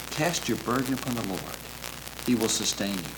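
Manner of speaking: very soft scripture recital; hushed, consoling close; gentle reassurance. Real, not acted. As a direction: let the verse settle quietly as comfort. Closes by gently repeating the psalm.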